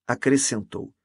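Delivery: ordinary delivery, nothing marked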